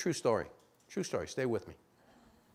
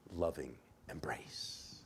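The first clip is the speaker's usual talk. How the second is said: whispered, slow and soft; tender whisper; 6 semitones lower, 7 dB quieter, slower than usual. A narrator's reading. Preacher whispering about the father's embrace. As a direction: breathe it out gently.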